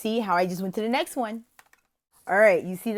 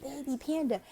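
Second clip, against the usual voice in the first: soft, higher voice with a gasp first; hushed wonder; 6 semitones higher, 8 dB quieter than usual. Shown as a demonstration. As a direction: softly marvel to a small child. Same demo heard again.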